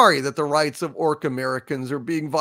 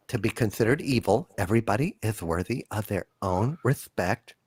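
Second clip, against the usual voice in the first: low, soft, halting, timid delivery; meek, over-careful game master parody; woke dungeon master; 9 semitones lower, 4 dB quieter than usual. Acted. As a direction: speak gently and haltingly, careful to offend nobody. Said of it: "What is a woke dungeon master like".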